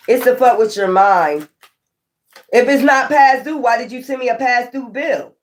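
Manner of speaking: ordinary talk, nothing marked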